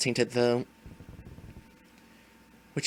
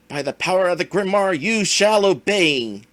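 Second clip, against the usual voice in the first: commanding villain; 3 semitones higher, 7 dB louder than usual. Acted. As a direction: pronounce it like a spell. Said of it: "let the villain monologue".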